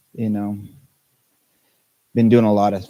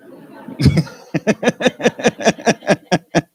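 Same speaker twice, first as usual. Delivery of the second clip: long, high, loud laughing fit; rapid string of helpless giggles; real laugh; 11 semitones higher, 6 dB louder than usual. Real, not acted. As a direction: crack up at your own joke. Laughs at own joke.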